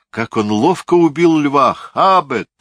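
high, loud arena cry; raving king cheering a kill; delirious cheering. A narrator's reading. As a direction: cheer the lion kill in fever. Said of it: "Он начинал бредить".

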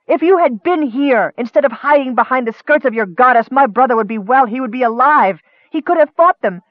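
high-pitched, heated accusation; angry reproach. Acted. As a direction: lash out with blame, pitch riding high. Bitter quarrel blaming him for brother.

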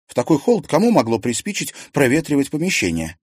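indignant. A narrator's reading. Liza objects to airing the room in the cold, indignant. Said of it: "возмутилась Лиза".

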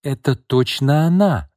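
high, slow, emphatic accusation; accusing declaration. A narrator's reading. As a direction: declare it with total certainty. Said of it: "заявила Лина".

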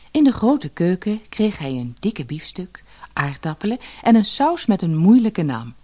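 ordinary delivery, nothing marked

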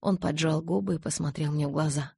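plain reading voice

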